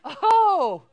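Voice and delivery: high, loud oh of discovery; a laughing oh; finally getting the joke; laughing realization. Retold or imitated.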